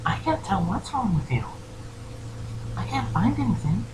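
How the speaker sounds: puzzled pony, high and softened; small high voice of a baffled caregiver; Fluttershy. Acted. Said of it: "proceeds to shrug and goes".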